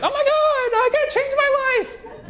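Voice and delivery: shrill high mock panic over one gray hair; panicked vain person. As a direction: squeal it like a vain person in crisis. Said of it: "they're like".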